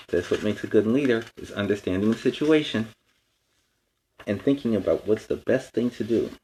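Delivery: ordinary delivery, nothing marked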